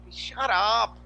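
loud, drawn-out shut up; exasperated shout. Acted. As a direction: shout them down in mock exasperation. Mocking reply to self-serious people.

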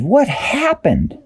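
high, slow, dazed voice; Dashi's voice. A narrator's reading. Dashi waking up, high dazed question. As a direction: drawl it up high, just waking. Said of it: "she said".

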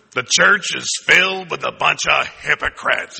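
put-on griping churchgoer voice; church critic. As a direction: draw out the critic's line with mock disdain. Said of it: "You gotta say it like that".

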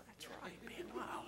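hushed mock wow from the pews; whispered mock reaction. Shown as a demonstration. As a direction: whisper it like a stunned churchgoer.

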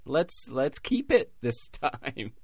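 high, forced cheer; mock enthusiasm. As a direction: fake delighted approval. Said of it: "be like".

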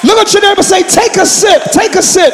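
high, full-force preacher shout; real excited pulpit yelling, pitched high; yelling, excited preaching. Real, not acted. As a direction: preach it at a fervent yell, building to the last word. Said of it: "I'm yelling".

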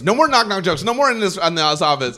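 high, loud imitated decree; exasperated boss impression; mock scolding. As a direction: lay down the law, hammed up. Acts out boss banning jokes.

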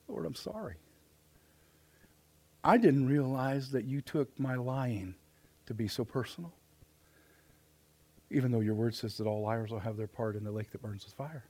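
soft, low confession; hushed repentant prayer. Acted. Sinner telling the Lord sorry for lying, hushed. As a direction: confess quietly, with long pauses.

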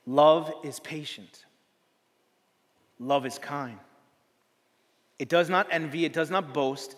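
slow, measured reading, swelling at the end; solemn scripture reading. Acted. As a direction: read it as sacred words.

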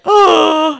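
loud, high exclamation; mock horrified reaction. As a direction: imitate being aghast at the sight. Retold shock at client's lashes.

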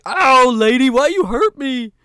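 loud, high, drawn-out whine; hurt big oaf. Acted. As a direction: bellow slowly like a wounded doofus. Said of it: "he's like".

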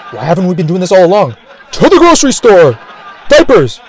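building to a high, loud holler; rally everyone with a hammed-up battle cry; mock excited outcry. The rest of us racing to the store, mock excited shouting. Acted crowd rushing to buy diapers.

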